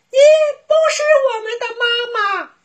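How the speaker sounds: put-on very high, fast child shout; little bunnies refusing together; bunnies in chorus. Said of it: "三个兔宝贝齐声说道".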